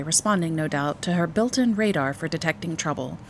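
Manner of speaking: plain reading voice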